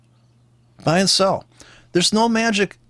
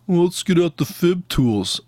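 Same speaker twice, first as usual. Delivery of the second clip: mocking; 3 semitones higher than usual. Mocking other traders' methods.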